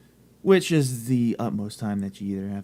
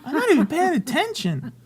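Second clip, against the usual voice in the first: very high, loud mock "huh?" with laughter; oblivious partner; 8 dB louder than usual. Mocking honey who ignored the subtitled show, high laughing impression. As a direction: imitate a clueless partner, squeaky and laughing. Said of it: "and she goes".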